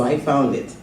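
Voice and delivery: normal talking voice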